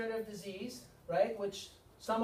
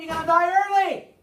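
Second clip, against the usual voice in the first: high, loud punchline; emphatic shout; 8 semitones higher, 12 dB louder than usual. Real, not acted. Speaker warning the audience, in a loud raised voice. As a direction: hit the ending hard and high. Climax of inflamed list.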